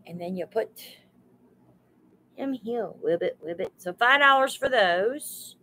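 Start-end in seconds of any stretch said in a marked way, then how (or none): 3.0-3.7 low, soft playful croak; low playful frog ribbit. Pretend. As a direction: croak softly like a little frog. Playing frog for frog statue.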